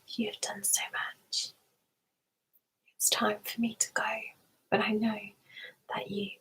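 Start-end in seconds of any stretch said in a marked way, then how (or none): none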